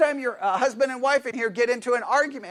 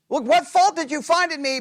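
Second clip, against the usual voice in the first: higher-pitched rhetorical question; rhetorical challenge; 5 semitones higher, 4 dB louder than usual. Acted. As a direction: ask it as if the answer is obvious. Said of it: "He's asking it in a rhetorical manner".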